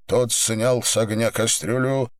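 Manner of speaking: plain reading voice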